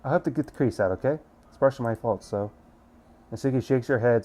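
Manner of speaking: ordinary talk, nothing marked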